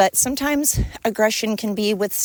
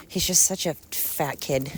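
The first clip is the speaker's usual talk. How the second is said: normal talking voice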